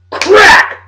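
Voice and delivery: sharp shouted crack sound, very high; loud crack. Pretend. Trash-talking Dave about a Super Bowl matchup, shouted crack sound. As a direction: smack the word out like a bone-crunching hit. Imitated hit in trash talk.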